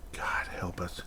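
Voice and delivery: breathy unvoiced whisper of despair. Real, not acted. Muttering to himself about the team, whispered. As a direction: hush it out like a prayer. Despairing aside after offsides rant.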